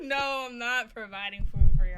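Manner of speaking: loud protest broken by laughter; laughing refusal. Real, not acted.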